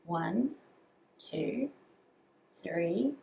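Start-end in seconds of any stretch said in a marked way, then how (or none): none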